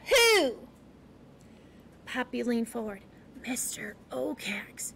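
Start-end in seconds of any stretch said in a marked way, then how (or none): 0.1-0.7 high, sharp one-word demand. A narrator's reading. Porcupine pressing Poppy for a name, demanding. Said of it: "demanded Aerith". 3.5-4.9 slow, hushed, fearful whisper. A narrator's reading. Poppy leaning forward to name the owl, whispering. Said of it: "she whispered".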